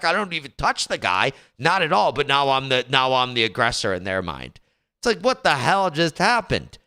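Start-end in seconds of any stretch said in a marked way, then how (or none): none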